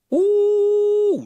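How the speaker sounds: put-on a sudden high shriek like a screaming child; imitated child scream. Preacher mimicking a first grader screaming in class, high shriek.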